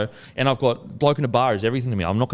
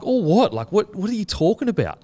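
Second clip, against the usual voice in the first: high, incredulous "what are you talking about"; retold disbelief; 9 semitones higher than usual. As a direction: replay your own baffled reaction, pitched up. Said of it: "And I was like".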